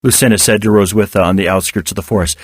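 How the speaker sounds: ordinary delivery, nothing marked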